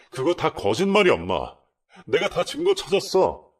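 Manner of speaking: high, loud indignant outburst; son's furious revelation; angry accusation. Retold or imitated. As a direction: expose the liars to mom. Son accuses couple to mother.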